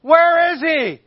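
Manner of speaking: explosive high shriek of outrage; very loud, high angry demand; fuming high priest. Acted. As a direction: shout it in acted disbelief and fury. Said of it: "He is really fuming at this".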